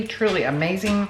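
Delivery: ordinary delivery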